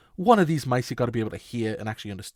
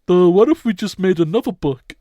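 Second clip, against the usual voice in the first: higher, loud dopey character voice; Gus impression; 5 semitones higher, 9 dB louder than usual. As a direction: blurt it out like a dim cartoon sidekick. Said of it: "And he says, ... he goes".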